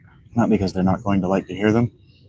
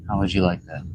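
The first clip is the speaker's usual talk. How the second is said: normal talking voice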